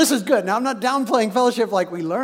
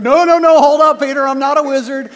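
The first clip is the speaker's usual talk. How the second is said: high, loud rush of denial; acted panicky objection; mock urgent protest; 6 semitones higher, 11 dB louder than usual. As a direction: cut in loudly to calm a false alarm. Hurried denial to startled Peter.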